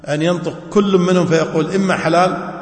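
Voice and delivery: ordinary delivery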